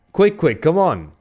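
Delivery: high, urgent hurrying along; urging. A narrator's reading. Sailor urging his friend away from the stranger, urgently.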